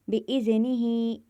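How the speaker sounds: बिइज़निही chanted slowly, the final ही held one alif's length; slow, stretch the ही at the end; slow Quranic recitation. Shown as a demonstration. Teacher reciting बिइज़निही to students, ही stretched. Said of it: "एक अलिफ के बराबर खींचकर पढ़ेंगे".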